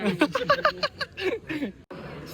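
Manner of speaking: long high-pitched laugh; real high laughter. Real, not acted. Bursts out laughing at the reply.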